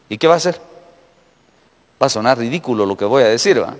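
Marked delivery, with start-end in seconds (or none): none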